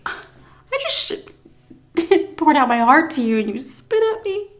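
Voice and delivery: half-laughing protest; indignant but giggling; laughing outrage. Retold or imitated.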